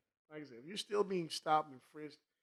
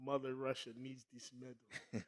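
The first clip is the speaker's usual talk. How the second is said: slow, heavy fake Russian accent; bad Russian accent; slower than usual. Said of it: "That's a terrible Russian accent".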